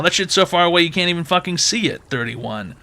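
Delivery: usual speaking voice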